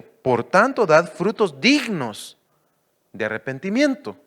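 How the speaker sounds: loud preaching read of scripture; preacher proclaiming scripture. Acted. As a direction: proclaim the verse to the church.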